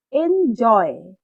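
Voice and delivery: Enjoy with জয় loud and drawn out; stressed second syllable. Shown as a demonstration. Teacher showing students which syllable is loud, stress on জয়. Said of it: "এটাকে loud আমাদেরকে, আহ, পড়তে হবে".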